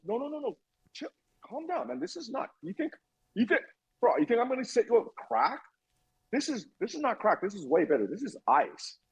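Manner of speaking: high, hushed mock reassurance; a friend talking him down, imitated; buddy calming him. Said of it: "He's like".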